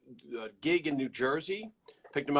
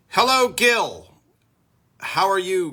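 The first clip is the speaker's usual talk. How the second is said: excited greeting; 6 semitones higher, 10 dB louder than usual. Real, not acted. Greeting a guest on stream.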